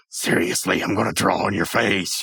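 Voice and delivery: low pull-string toy voice; low, deadpan doll threat; creepy talking doll. Retold or imitated. Podcaster voices the doll after the string pull, low creepy voice. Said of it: "and the doll goes".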